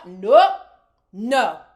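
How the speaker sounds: loud emphatic no; flat rejection. Real, not acted. Host rejecting Sincere's explanation, loud no. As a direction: shut the excuse down hard.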